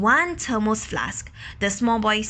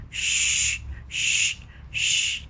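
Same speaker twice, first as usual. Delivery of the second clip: shushing; much slower than usual. Acted.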